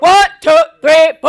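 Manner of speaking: barked marching cadence, very loud; drill sergeant cadence. Acted. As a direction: shout the count like a drill instructor. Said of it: "do a little cadence".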